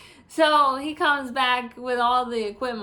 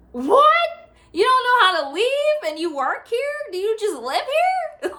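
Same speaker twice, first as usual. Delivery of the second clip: much higher and louder, incredulous; incredulous outrage; 10 semitones higher, 5 dB louder than usual. Real, not acted. Mocking a nurse who can't find the exit, loud and incredulous. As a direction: call out the absurdity.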